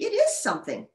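high, insistent exclamation; crying out. A narrator's reading. Pig praises modest Goose, crying out. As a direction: insist on it eagerly, pitched high. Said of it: "cried Pig".

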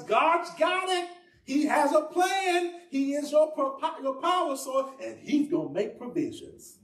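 high, drawn-out pulpit declarations; impassioned preaching. Real, not acted. Preacher reminding congregation of God's plan, impassioned. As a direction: preach it high, stretching each phrase.

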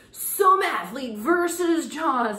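a hammed-up movie trailer voice, slow; trailer announcer. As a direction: sell it like a hyped TV special. Mocking a TV promo title.

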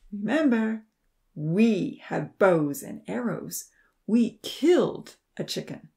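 slow, weighted warning; deliberate threat. A narrator's reading. Odin reminding farmers of bows and arrows, threatening. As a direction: spell out the threat slowly, pausing.

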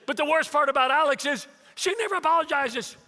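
high, loud mock complaint; exasperated son. Son complains to dad about his wife, exasperated. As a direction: imitate an aggrieved husband venting.

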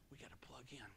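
a sudden hushed whisper; a whispered aside to the congregation. Real, not acted. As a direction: lean in and whisper it to the room. Preacher drops to emphasise.

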